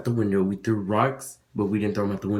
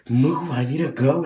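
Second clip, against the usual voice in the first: louder, higher mock yell to get him moving; impatient shout; 5 semitones higher, 5 dB louder than usual. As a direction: shove him along with your voice, half-shouting. Pushing the slow kid over wall.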